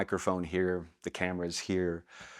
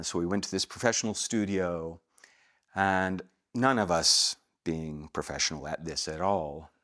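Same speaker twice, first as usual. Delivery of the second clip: ordinary talk, nothing marked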